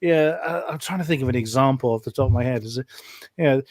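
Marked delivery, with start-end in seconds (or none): none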